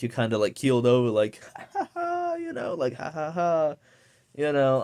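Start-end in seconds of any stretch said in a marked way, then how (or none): 1.6-2.3 very high, thin mock cackle; hammed-up laugh pitched way up; imitated high laugh. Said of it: "I've had laughter where". 3.0-3.7 lighter mock laugh; a short put-on ha ha ha; imitating someone laughing too much; imitated laugh. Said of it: "I've had laughter where".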